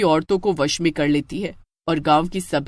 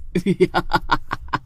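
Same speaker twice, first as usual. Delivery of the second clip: witch cackle. Acted. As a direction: chuckle with menace. Witch gloats. (चुड़ैल की धीमी बुरी हँसी)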